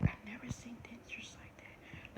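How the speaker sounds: hushed, shocked whisper; whispered quote. Retold or imitated. Friend seeing her dentures, whispering in shock. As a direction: whisper it like someone aghast. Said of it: "and they were like".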